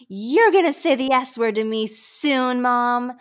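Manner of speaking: mild mock yell of a son talking back; retold kid's yell. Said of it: "my son yelled back at me".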